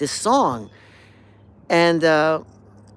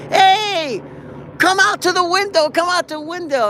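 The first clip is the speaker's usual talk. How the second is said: much higher, louder shout up to a window; calling out; 7 dB louder than usual. Retold or imitated. Standing on the street, yelling up to the neighbor's window. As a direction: call up from the street. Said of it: "I'm yelling at".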